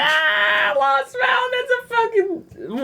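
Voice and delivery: very high, strained mock shout; mock yell. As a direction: squeal it out like a riled-up brawler. Mock brawl, joining the act.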